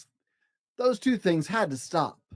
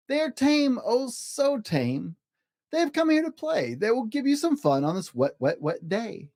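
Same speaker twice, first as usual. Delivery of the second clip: higher, coaxing cat voice; lightly voiced cat; 7 semitones higher than usual. A narrator's reading. Cat reassures the fish that things are tame, coaxing. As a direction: soothe them like a sly salesman. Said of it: "said the cat".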